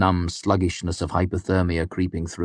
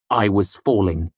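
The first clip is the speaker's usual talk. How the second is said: ordinary delivery, nothing marked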